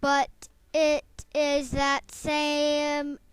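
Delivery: loud, slow, reassuring frog voice; the frog comforting the weeping mouse; comforting Magic Frog. Acted. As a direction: soothe the crying mouse. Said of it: "said Magic Frog".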